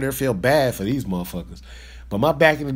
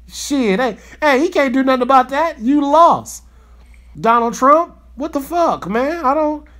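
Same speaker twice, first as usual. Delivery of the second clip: higher and louder, hammering it home; fired-up shout; 9 semitones higher, 5 dB louder than usual. Real, not acted. Angry vlogger shouts at Trump that he lost. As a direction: yell the verdict at him.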